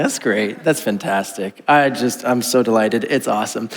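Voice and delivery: low, quiet, flat-out sarcastic "that's great"; sarcastic deadpan. Retold or imitated. Answering his wife's snow-day text, in quiet mock delight. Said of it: "I'm like".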